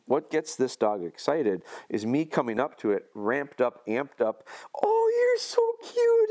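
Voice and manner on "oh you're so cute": high, slow cooing at a puppy; drawn-out squeaky baby voice for a dog; gushing baby talk. Retold or imitated. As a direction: coo like you're greeting a cute puppy. Cooing over an excited puppy.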